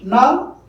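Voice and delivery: teacher isolating the stressed syllable; isolated stressed syllable. Shown as a demonstration. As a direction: shout the syllable Now out on its own. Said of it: "that is stressed".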